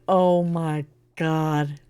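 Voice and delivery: slow, low, drawn-out dismay; stunned. Real, not acted. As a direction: let the price sink in, dragging each word. Shocked at the printing price.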